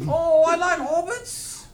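high, slow caricature voice; hammed-up director impression, half laughing; Peter Jackson. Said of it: "Do, uh, Peter Jackson".

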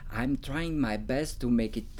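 normal talking voice

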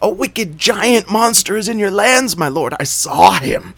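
high, loud frightened report; frightened jackal. A narrator's reading. Jackal telling the king of the giant, high and frightened. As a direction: gasp out the horror.

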